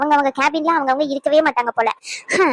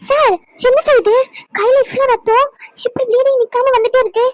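high-pitched worried alarm at an injury; 7 semitones higher, 3 dB louder than usual. Acted. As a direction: fuss urgently over a bleeding hand. Nurse alarmed at bleeding hand. (பதறிப்போன உயர்ந்த குரல்)

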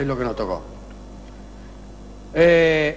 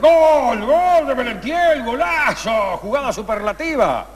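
excited goal call; 8 semitones higher, slower than usual. Real, not acted. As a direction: call the goal like live radio. Radio goal call replay.

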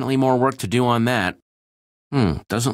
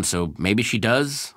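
ordinary talk

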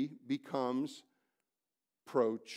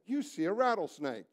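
normal talking voice

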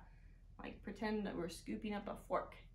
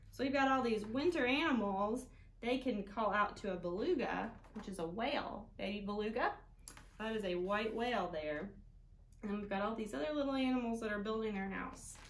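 ordinary delivery

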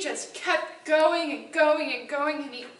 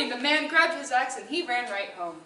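ordinary delivery

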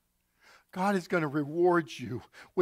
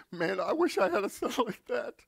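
higher, laughing wistful wish; a man envying the father; admiring businessman; 5 semitones higher than usual. Acted. Businessman admiring son, laughing.

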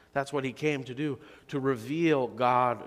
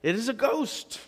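terrified cry; 9 semitones higher than usual. A narrator's reading. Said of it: "they were terrified and said".

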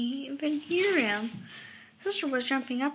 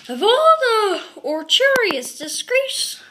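high, loud, indignant rant; scandalized shouting; outraged roar; 7 semitones higher, 9 dB louder than usual. A narrator's reading. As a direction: roar it like a furious Papa Bear. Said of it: "he roared".

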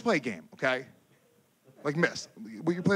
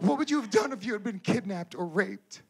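put-on higher, tearful-edged girlfriend asking; worried girlfriend; 7 semitones higher than usual. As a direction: plead it as the scared girlfriend. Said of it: "She was like".

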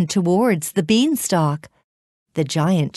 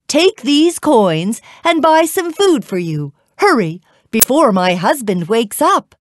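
higher-pitched, insistent giantess; urgent urging; 6 semitones higher, 4 dB louder than usual. A narrator's reading. Giant woman sends Jack off, urgently. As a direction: press him to get away.

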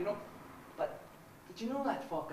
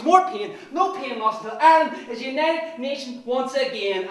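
loud, higher, relentless shouting; fanatical ranting; 6 semitones higher, 18 dB louder than usual. Acted. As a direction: rally cry of hatred.

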